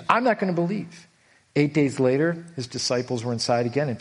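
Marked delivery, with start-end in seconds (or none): 0.1-1.1 stubborn refusal. Retold or imitated. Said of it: "He was emphatic".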